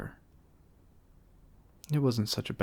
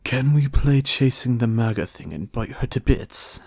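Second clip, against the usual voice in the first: louder gleeful wolf; a beast begging for the hunt; Wolf eager; 3 semitones higher, 9 dB louder than usual. A narrator's reading.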